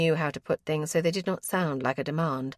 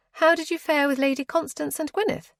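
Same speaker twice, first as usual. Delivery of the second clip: higher, eager question; eager cousin; 9 semitones higher, 3 dB louder than usual. A narrator's reading. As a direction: ask brightly and curiously.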